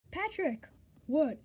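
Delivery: ordinary delivery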